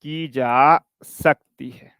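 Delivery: की जा सकती है drawn out very slowly; teacher repeating the line for note-taking; slow dictation. Shown as a demonstration. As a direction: dictate the ending word by word. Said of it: "लिख लो".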